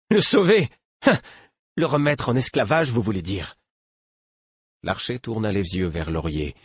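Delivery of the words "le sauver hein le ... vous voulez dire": put-on much higher, sneering young voice; mock the word sauver; scornful boy. A narrator's reading. Captive boy scoffs at his captor's rescue.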